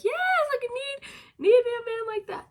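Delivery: high, loud swoony gush; swooning. Pretend. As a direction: gush over the dream man. Imagined swooning over male lead.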